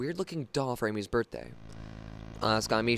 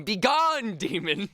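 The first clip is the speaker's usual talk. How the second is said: mock exorcist; 8 semitones higher, 4 dB louder, slower than usual.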